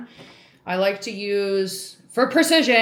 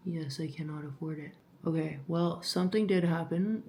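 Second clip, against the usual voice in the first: ordinary talk, nothing marked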